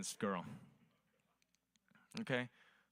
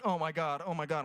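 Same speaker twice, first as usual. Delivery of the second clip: loud mock ecstasy; 5 semitones higher, 5 dB louder than usual. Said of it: "she's like".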